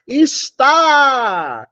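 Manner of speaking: "Está" called out, loud, weight on TÁ; stress on TÁ. Shown as a demonstration. Teacher demonstrating oxytone to class, emphatic call. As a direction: punch the final syllable of "Está". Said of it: "oxítona terminada em A".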